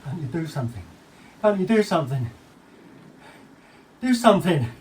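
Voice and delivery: desperate, climbing appeal; pleading for help. Acted. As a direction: beg someone to step in, increasingly urgent. Son pleads for help, rising urgency.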